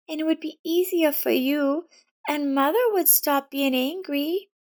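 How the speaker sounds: put-on a scared child blaming herself; frightened girl. A narrator's reading. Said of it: "A frightened Elizabeth approached her".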